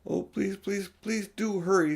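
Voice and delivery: high, urgent begging; a mild chorus of impatient children; pleading children. A narrator's reading. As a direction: beg her to hurry up. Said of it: "said the three children".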